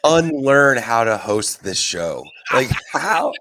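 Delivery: loud, high exasperation building on each how; exasperated outburst. Real, not acted. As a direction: demand it in disbelief. Exasperated at host's writing.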